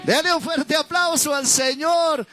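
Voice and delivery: high-pitched revival preaching; fervent high sermon delivery; impassioned preaching. Real, not acted. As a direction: proclaim it high to the whole congregation.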